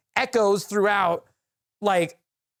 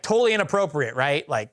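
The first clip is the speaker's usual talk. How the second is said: ordinary delivery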